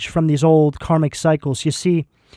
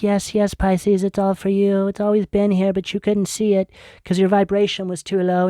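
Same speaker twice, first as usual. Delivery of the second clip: reassuring spirit guides; 4 semitones higher than usual. Retold or imitated. Spirit guides comforting the viewer, gentle and higher. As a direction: lightly voice kind guides welcoming you home. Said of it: "Your spirit guides are like".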